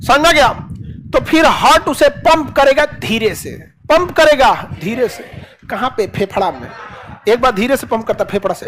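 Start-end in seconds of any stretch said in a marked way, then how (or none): none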